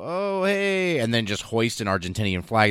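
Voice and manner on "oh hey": mock-breezy greeting, pitched much higher; casual scrap workers. Scrap workers breezily greeting as they land, high and mock-casual. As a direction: chirp it like it's no big deal. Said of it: "scrap workers just kinda go over there and go".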